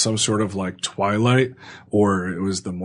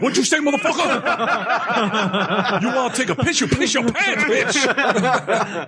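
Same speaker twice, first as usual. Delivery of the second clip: very high, hammed-up tough talk through laughter; mock yelling; 14 semitones higher than usual. Pretending to threaten a muscular orderly, laughing mock yell. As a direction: play at bullying, voice squeaking high with laughter. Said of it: "never yell at a muscular orderly".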